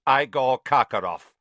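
crisp, clipped-off name call; stern courtroom official, loud and curt. A narrator's reading. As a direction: announce the name curtly like a judge. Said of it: "said a curt voice".

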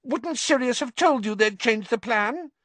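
high-pitched frantic pleading; imploring squeak. A narrator's reading. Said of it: "writhing imploringly".

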